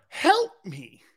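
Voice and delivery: lightly voiced weak plea for help; mock pleading like in the movie; Jerry Maguire plea. Pleading to teammates like the movie scene, lightly voiced. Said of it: "I sound like Jerry Maguire".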